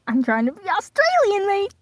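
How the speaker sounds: over-the-top mock-Australian; exaggerated Australian accent. Shown as a demonstration.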